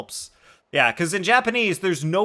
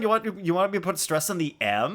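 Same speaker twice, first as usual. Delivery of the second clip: normal talking voice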